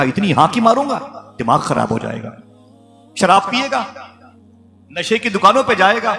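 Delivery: hammed-up brag thrown back at the listener; mock threat. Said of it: "تو نے کہا".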